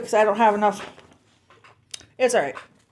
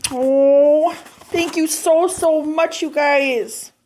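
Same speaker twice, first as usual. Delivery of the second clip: higher, tender, heartfelt thanks; touched gratitude; 4 semitones higher, slower than usual. Real, not acted. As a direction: thank them as if moved near tears. Moved by friends' birthday card.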